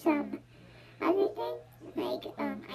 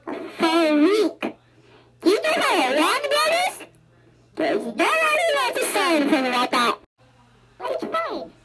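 exasperated shout; 4 semitones higher, 10 dB louder than usual. Real, not acted. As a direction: shout it like it's outrageous. Kid ranting about long work.

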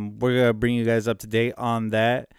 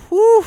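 relieved whew; 11 dB louder than usual. Retold or imitated. Relief while retelling the game.

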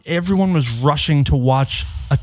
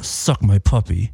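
teeth together; 7 semitones lower than usual. Retold or imitated. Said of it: "I said".

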